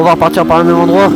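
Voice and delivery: talking loud inside a helmet while riding. Real, not acted. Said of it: "on se retrouve tout de suite dans le casque".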